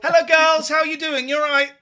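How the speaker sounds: high, loud, cheery hello. Real, not acted. Host greeting a caller's girls before bed, loud and cheery.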